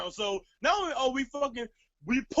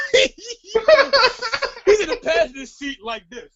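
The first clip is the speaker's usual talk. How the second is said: laughing; 12 semitones higher, 10 dB louder, much slower than usual. Real, not acted. Laughing while describing friend.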